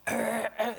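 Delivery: throaty retching noises; imitated retching. Preacher imitating the woman bringing up slime, retching. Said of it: "some slimes are coming out".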